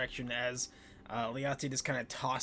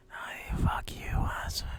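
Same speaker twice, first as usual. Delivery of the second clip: breathy whisper as a distant voice; whispered retort. Acted. Downstairs voice answering a thrown book, whispered. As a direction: whisper the insult back.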